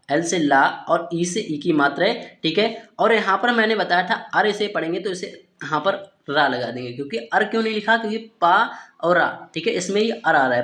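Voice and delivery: ordinary talk, nothing marked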